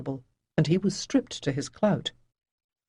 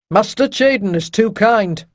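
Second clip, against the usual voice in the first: dry captain; 5 semitones higher, 7 dB louder than usual. Acted. Rydell dry remark.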